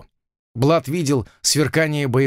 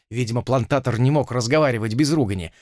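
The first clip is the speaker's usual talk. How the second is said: ordinary delivery, nothing marked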